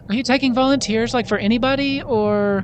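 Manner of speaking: high-pitched hopeful little voice; eager, unsure Oki. Acted. Oki pops her head out to volunteer, high and hopeful. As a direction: pitch it up, asking tentatively.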